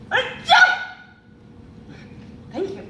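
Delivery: sudden high squeaky yeah; a mock delighted yelp; forced cheer. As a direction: fake enthusiasm jumping way up. Forced delight at moldy flower.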